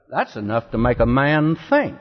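ordinary talk, nothing marked

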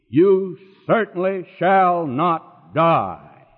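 very slow, word by word; drawn-out, deliberate "certainly shall not die"; sinking in. Retold or imitated. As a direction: space out each word so it sinks in. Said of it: "how it was sinking in the ear".

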